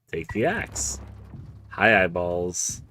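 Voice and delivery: ordinary talk, nothing marked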